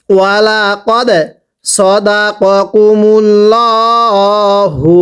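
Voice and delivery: সদাকাকুমুল্লাহু recited very slowly, mim held nasal; nasal ghunnah on mim. Shown as a demonstration. Teacher models the verse for students, slow recitation with ghunnah. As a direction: draw out the মিম in সদাকাকুমুল্লাহু with a long nasal hum. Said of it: "মিম সাকিন গুন্নাহ করে পড়তে হয়".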